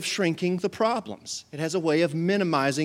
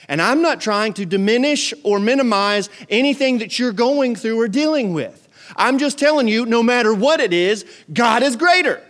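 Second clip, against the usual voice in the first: raised, loud sermon voice; forceful preaching; 7 semitones higher, 6 dB louder than usual. Real, not acted. Preacher assures church God is greater, emphatic preaching. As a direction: drive the point home to the congregation.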